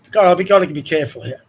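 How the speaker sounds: ordinary delivery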